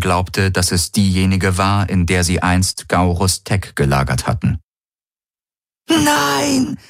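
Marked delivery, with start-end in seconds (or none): 5.9-6.8 very high, drawn-out scream; high shriek held long; screamed protest. A narrator's reading. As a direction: scream it in desperate refusal. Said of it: "schrie Ylana unaufhörlich".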